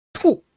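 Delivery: 투 starting high and dropping sharply; 투 in an abrupt falling tone; falling fourth tone. Shown as a demonstration. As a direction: say 투 loud and high, then let it plunge. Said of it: "뚝 떨어지는 제 사성입니다".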